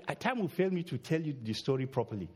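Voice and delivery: ordinary talk, nothing marked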